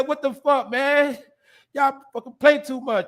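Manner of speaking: a hint of a four-year-old cussing out the grown-ups; imitating the kid. Retelling the boy woken with water, light mock kid voice. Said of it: "he said".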